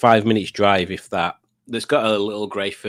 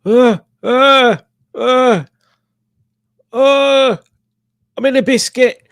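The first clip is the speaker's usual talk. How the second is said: high and loud, stammering like a creature stuck in a biscuit; hammed-up squeaky voice peering through a party ring; cartoon critter voice, much higher and louder; silly biscuit voice; 13 semitones higher, 8 dB louder, slower than usual. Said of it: "watch this".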